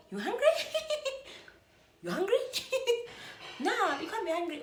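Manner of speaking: high, giggly teasing with squealed laughs; teasing mock voice. Date teasing the speaker about being hungry, high giggly mockery. Acting out teasing reply to hunger.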